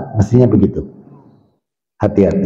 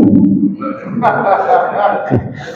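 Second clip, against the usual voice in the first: laugh; 6 semitones higher, 3 dB quieter than usual. Real, not acted. Laughing after joke.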